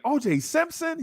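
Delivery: high, astonished question; amazed kid. Retold or imitated. Kid hearing the comparison, high astonished. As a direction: gasp the name in disbelief. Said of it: "I was like".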